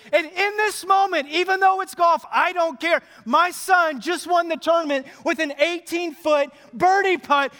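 high-pitched, excited proclamation; exultant dad. Acted. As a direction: boast at full voice about the win. Father exults over son's win.

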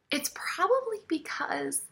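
breathy confiding whisper. Real, not acted. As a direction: lean in and whisper it like a secret. Confiding aside to congregation.

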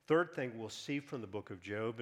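ordinary talk, nothing marked

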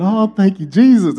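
high, loud thankful cry; father thanking God for a son; joyful praise. Retold or imitated. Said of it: "I said".

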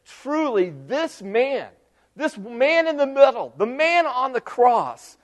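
high, slow, awestruck declaration; awed exclamation. Acted. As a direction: marvel at him, drawing it out. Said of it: "he cried out like this".

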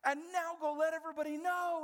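excited high urging voice; eager Peter. Retold or imitated. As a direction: Peter cheering them on to go tell everyone. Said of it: "He's like".

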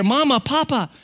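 put-on high, little-girl call; high-pitched toddler voice; imitated toddler. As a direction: call out like a small daughter. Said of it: "suddenly we hear".

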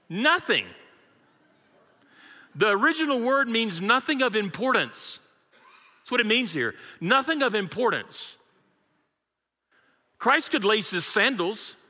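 normal talking voice

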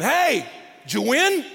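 high, drawn-out chirpy asking; clueless cheery question. Acted. Asking a team beaten thirty-eight to nothing, cluelessly cheerful. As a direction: ask brightly, oblivious to the defeat. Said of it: "like asking a football team after they just get beat".

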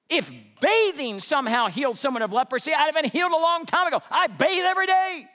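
high, loud offended outrage; indignant rant. Retold or imitated. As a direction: rant like a proud general insulted. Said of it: "Naaman's mad".

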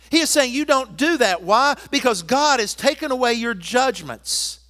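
loud, high, proclaiming preacher voice; full-force preaching. Acted. Pastor rousing the church about conquered enemies, full-force preaching. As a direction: preach it out to the whole congregation.

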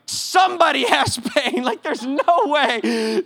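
high, drawn-out disbelieving plea; exasperated disbelief. Retold or imitated. Acting out his exasperation with crowd.

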